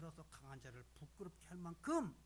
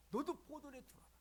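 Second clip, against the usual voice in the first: higher, fuller voice of God calling; God's voice, tender; 8 semitones higher than usual. Retold or imitated. God calling each believer precious, higher and fuller. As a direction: call out as God speaking to his child. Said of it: "귀하게 계시".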